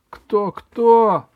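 high, loud incredulous question; loud astonishment. Acted. Wolf hears of the gnome's bride, loudly astonished. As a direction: burst out in disbelief.

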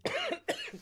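sharp choking cough. Real, not acted. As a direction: cough hard, something went down wrong. Chokes on drink mid-talk.

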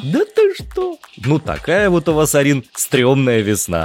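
squeaky high "no way!", ironic; sarcastic high-pitched astonishment; mock amazement. Real, not acted. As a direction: feign shock at the spring weather.

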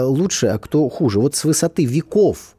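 ordinary delivery

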